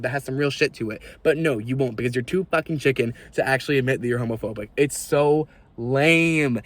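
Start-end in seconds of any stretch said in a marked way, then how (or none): 4.8-6.6 drawn-out, very slow "It's so lame" with a dragging fall on "lame"; disgusted. Real, not acted. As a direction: let "It's so lame" sag out slowly in disgust. Disgusted sigh at homophobes.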